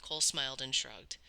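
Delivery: ordinary delivery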